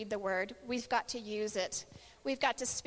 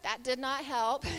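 ordinary delivery

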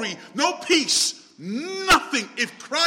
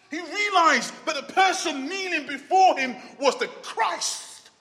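ordinary talk

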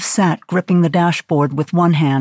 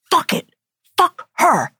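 high, spat-out curse; a mild angry outburst; 10 semitones higher than usual. A narrator's reading. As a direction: curse her in a rage. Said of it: "Sarah said".

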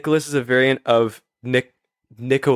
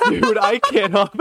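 laughing; 10 semitones higher, slower than usual. Real, not acted. Cracking up at the joke.

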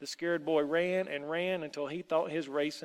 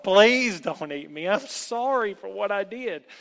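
loud, desperate pleading; begging for my life; 6 semitones higher, 10 dB louder than usual. A narrator's reading. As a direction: beg loudly for mercy. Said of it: "beg for his life".

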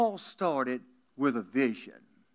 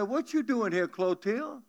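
higher, startled question to his wife; surprised Boudreaux; 8 semitones higher than usual. Acted. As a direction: raise it up in surprise.

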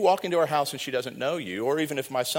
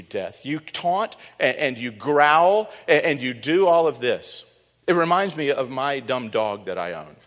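normal talking voice

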